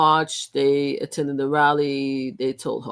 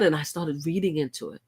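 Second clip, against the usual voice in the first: ordinary delivery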